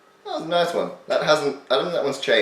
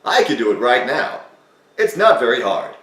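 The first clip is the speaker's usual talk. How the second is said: American accent; 4 semitones higher, 7 dB louder than usual. Shown as a demonstration. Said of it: "my voice with an American accent".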